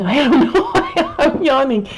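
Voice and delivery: giggling through a sleepy admission; laughing at herself. Real, not acted. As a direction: laugh it off, a bit embarrassed.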